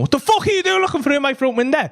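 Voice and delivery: high-pitched irate demand; angry confrontation. Retold or imitated. Machete man facing a stranger at his window, angry demand. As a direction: demand an answer from an intruder.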